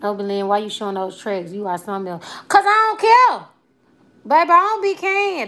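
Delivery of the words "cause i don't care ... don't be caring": high, sassy brush-off; defiant. Real, not acted. Woman answers a commenter about her showing tracks, defiant. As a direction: toss it back high-pitched and unbothered.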